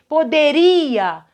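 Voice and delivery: poderia drawn out slowly on RI; stress on RI. Shown as a demonstration. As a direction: pronounce poderia slowly, stretching the ri. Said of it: "tudo fora do radical".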